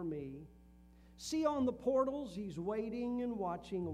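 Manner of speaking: hymn lyrics recited with rising fervor, swelling loud in places; reciting a hymn. Acted. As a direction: preach the hymn words, building to loud peaks. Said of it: "old invitation song that says".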